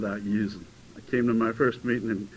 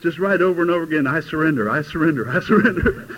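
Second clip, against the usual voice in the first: urging; 4 semitones higher, 7 dB louder than usual. Retold or imitated. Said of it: "I said".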